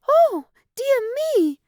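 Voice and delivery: put-on very high, loud, startled girl; startled cry. A narrator's reading. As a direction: cry out in shock on waking. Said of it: "cried Goldilocks".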